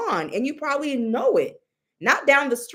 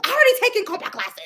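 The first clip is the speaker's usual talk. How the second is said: high, fast, put-on voice; mocking client; 9 semitones higher, much faster than usual. Reader mocking the client's excuse, high and fast. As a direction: parrot her in a quick, squeaky voice. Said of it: "Go dance some comp".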